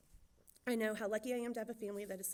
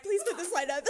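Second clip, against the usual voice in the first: louder and higher, laughing through it; laughing plea; 9 semitones higher, 11 dB louder than usual. Real, not acted. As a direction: beg the tech crew while giggling. Laughing plea to tech crew.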